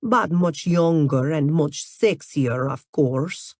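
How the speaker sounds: put-on slow, teasing Russian-accented model. A narrator's reading. Natalia flirts with Grayson in a Russian accent. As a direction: purr it in a thick Russian accent. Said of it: "in her thick Russian accent".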